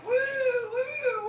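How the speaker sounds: high-pitched party whoops; sky-high celebratory woo; excited whoops. Retold or imitated. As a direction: whoop it up like a night out in Vegas. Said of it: "That I'm like".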